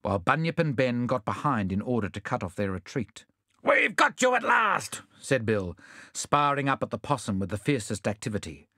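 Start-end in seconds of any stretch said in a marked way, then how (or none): 3.6-5.0 shouting in triumph; fierce shout. Acted. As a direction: yell it, high and loud. Bill confronts the thieves.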